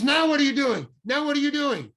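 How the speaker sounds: loud, high angry shout. Retold or imitated. Director yelling at the agent holding his hair, loud and high. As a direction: bellow the question at her.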